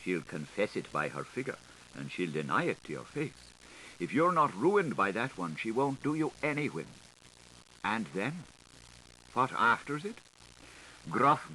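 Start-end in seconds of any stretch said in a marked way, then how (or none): none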